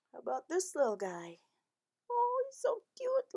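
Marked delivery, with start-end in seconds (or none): 2.1-3.3 much higher, breathy coo. Acted. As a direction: coo over something adorable. Cooing over puppy picture.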